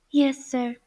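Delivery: ordinary delivery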